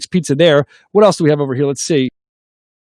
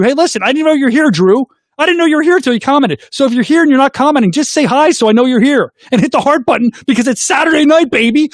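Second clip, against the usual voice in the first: excited, higher-pitched livestream hype; rally the viewers with raised pitch; pumped Saturday-night host; hyped-up host; 7 semitones higher than usual. Real, not acted. Hyping up viewers to join in.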